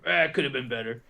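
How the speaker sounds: unimpressed judge voice; grumpy judge. Pretend. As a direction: sigh it out, unimpressed. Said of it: "they're like".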